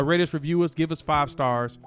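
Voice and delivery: usual speaking voice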